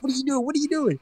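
very high-pitched imitation of an exasperated yell; high, urgent mock yell; mock screaming coach. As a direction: imitate a manager screaming at his player, without full volume. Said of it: "He's, like, screaming at him".

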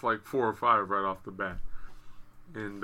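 ordinary talk

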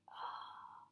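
very soft, breath-only "oh"; gasp of disbelief. Acted. Believers see Jesus standing there, hushed disbelieving gasp. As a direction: breathe out a stunned, voiceless oh. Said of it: "in disbelief".

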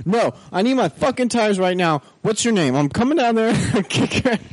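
high hammed-up ranting threat; mock furious customer. Customer on the phone threatening to kick ass, high mock fury. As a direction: squeak out the threats like an outraged caller. Customer demands his tires.

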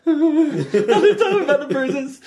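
put-on high-pitched, slow boy voice broken by laughter; retelling the game scene, cracking up as the boy; laughing while quoting. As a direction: giggle through a squeaky kid line. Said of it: "And I was like".

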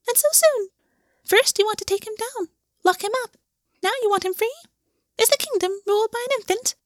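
very high, chirping bird voice; chirpy paper bird. Acted. Origami bird greets Gwen, high mocking chirp. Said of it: "the bird chirped".

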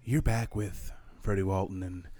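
laid-back late-night DJ patter; mock smooth-radio intro; smooth radio host. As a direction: ease listeners in, unhurried.

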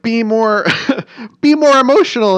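high, loud insistent push; mock advisers demanding. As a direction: bark the advice at her like handlers. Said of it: "They were like".

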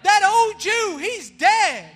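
loud, rising preacher's call to the crowd; full-force preaching. Acted. Pastor preaching forgiveness to his church, loud and fervent. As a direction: preach it out to the congregation.

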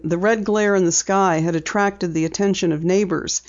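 usual speaking voice

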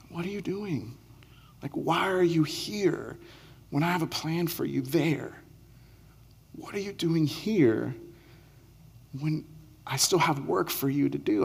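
tender voice. Retold or imitated. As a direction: ask it quietly like a caring father. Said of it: "but the tender voice".

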